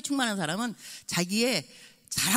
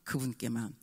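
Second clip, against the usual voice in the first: quiet solemn; 8 semitones lower, 8 dB quieter than usual. Retold or imitated. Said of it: "이렇게 말을 하거든요".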